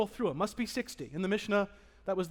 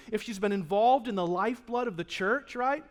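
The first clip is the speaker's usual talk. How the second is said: usual speaking voice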